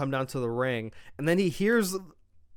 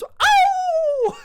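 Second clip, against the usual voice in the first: sharp, very high and loud yelp of pain; 9 dB louder than usual. Retold or imitated. Solo hit by a hip attack, screaming a very high yelp. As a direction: squeal it out like a wrestler taking a hit.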